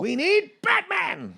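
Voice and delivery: very high whiny plea. Pretend. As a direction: whine it high and slow. Mock studio panic.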